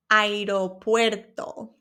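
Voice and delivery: Aeropuerto said slowly, syllable by syllable. Shown as a demonstration. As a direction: Aeropuerto broken into slow parts. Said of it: "say it slowly".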